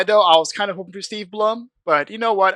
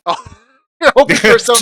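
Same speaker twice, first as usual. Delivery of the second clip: teasing, laughing and louder; laughing tease; 6 semitones higher, 8 dB louder than usual. Real, not acted.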